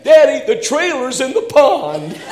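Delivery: put-on high, loud, urgent child's cry; son calling out. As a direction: shout it like a kid at the window. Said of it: "he's at the front window saying".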